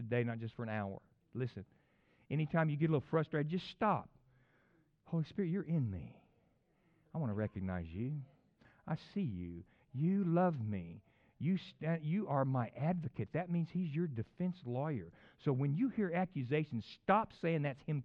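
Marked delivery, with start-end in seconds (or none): 5.1-13.3 hushed, sincere acknowledgment; calm prayer. Shown as a demonstration. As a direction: speak intimately, pausing between phrases. Models a prayer to Holy Spirit.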